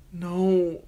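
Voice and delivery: high, frightened whimper; a small cornered whimper; terrified girl whimpering no. A narrator's reading. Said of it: "she whimpered".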